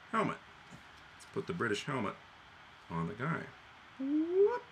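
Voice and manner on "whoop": excited whoop. Real, not acted. Excited helmet demo.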